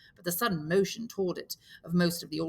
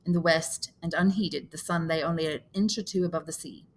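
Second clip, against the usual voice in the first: ordinary delivery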